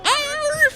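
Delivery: short high-pitched scream. Acted. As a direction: let out a sudden shriek. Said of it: "then you hear".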